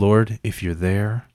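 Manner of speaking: slow, low plea to heaven; mock prayer. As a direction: pray slowly for patience.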